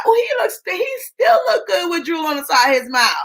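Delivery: high, delighted mock swoon; playful swooning. Wife admiring her drooling husband, playfully swooning.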